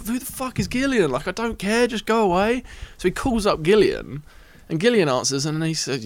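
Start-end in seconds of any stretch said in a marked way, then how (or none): 0.1-2.6 exasperated. Retold or imitated. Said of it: "I'm going".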